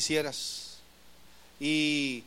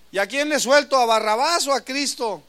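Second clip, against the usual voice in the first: Pilate calling out to the crowd; 6 semitones higher, 7 dB louder than usual. Retold or imitated. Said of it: "Pilate questions the crowd".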